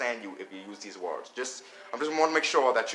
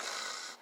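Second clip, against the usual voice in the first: quiet dry gargle from the throat; French R demo; 12 dB quieter than usual. Shown as a demonstration. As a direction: show the throaty R softly, tongue unused. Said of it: "Make sure you get that".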